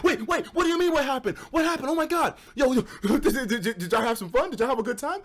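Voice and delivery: high, eager questions tumbling out with a laugh; excited curiosity. Retold or imitated. Asking his friends on the phone about the girls, eagerly curious. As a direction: sound thrilled and nosy about the night. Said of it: "I'm like".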